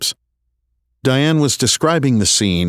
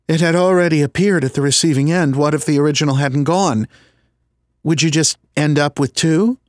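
Sandra's voice; 8 semitones higher than usual. Acted.

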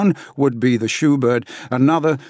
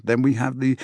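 normal talking voice

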